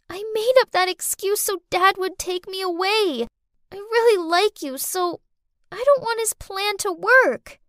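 put-on high-pitched child voice; little girl, earnest confession. A narrator's reading. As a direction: own up as a child pleading to be believed. Child figure in the story.

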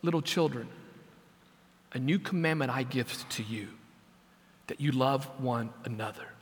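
put-on soft, low, tender words to children; quiet, loving hush with unhurried pauses. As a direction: quote it gently, voice dropped low and quiet. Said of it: "Jesus very tenderly says".

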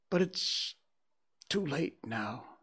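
high start sinking slowly away; regretful. A narrator's reading. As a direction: let it trail down in regret. Scrooge regretting, after crying.

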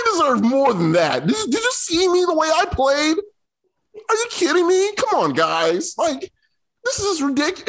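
high, indignant whine of an underpaid star; underpaid athlete impression. Host mocks Dennis Schroeder's paycheck in a high, indignant whine. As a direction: complain like a star insulted by his paycheck. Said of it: "do Dennis Schroeder".

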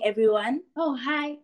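usual speaking voice

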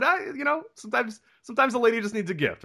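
high, faux-innocent sweetness; innocent husband. Husband covering his scheme, mock innocent and high. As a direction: play it coy and innocent. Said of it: "And I said".

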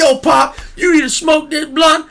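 high, loud shouted thug voice; mock thug shout. Comedian acting out the bad comic's joke, in a shouted mock thug voice. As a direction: yell it like a gangster son to his pop. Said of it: "I'd be like".

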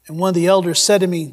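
usual speaking voice

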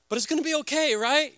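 high, bright put-on pastor optimism, rising at the end; forced reassurance. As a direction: sell a hopeful front you don't feel. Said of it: "I would say stuff like".

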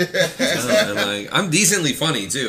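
laughing. Real, not acted. Laughing at comedy question.

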